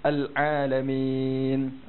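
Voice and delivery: very slow "Al-Alamin" with a moderate Ain; a measured, balanced Ain in "Al-Alamin"; middle Ain. Shown as a demonstration. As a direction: say "Al-Alamin" with a balanced Ain, neither pressed nor lazy. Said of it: "Okey, cuba sebut".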